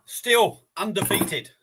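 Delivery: triumphant boast. Real, not acted. Boasting after finishing the curry.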